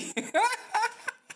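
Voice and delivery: high, airy laugh at his own joke; a bright laugh after the mocking chant; burst of laughter. Real, not acted.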